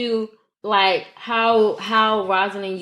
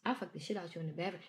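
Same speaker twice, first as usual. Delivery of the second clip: lame delivery; 2 semitones lower, 21 dB quieter, faster than usual. Shown as a demonstration. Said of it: "real lame with it".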